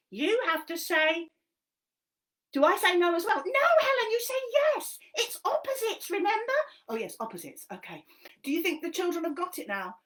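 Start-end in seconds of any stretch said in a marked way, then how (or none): 3.4-6.5 high, loud cheeky monkey voice; squeaky puppet monkey helping Helen. Acted. Monkey puppet explaining a game to Helen, high and bouncy.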